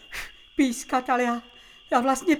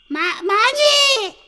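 calling out; 4 semitones higher, 9 dB louder, much slower than usual. Acted. Calling for Mánička in woods.

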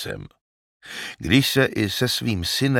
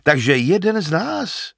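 higher, louder delighted exclamation; shepherd thrilled that the hero is a commoner; shepherd's exclamation; 11 semitones higher, 5 dB louder than usual. Acted. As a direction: exclaim with glad surprise. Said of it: "zvolal ovčák".

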